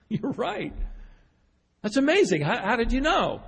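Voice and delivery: high, astonished outburst; amazed. Retold or imitated.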